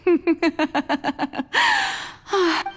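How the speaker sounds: high, rising burst of delighted laughter; amused laugh. Acted. God laughs at the travelers' shocked faces, high delighted burst of laughter. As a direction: laugh at their shocked faces, unable to hold it in. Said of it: "I couldn't help myself".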